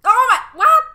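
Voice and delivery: high, loud shriek of shock; startled shriek. Real, not acted. Player caught off guard by the game, loud shocked shriek. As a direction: jolt up into a sudden loud cry. Sudden game jump scare.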